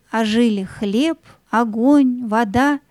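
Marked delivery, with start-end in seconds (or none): none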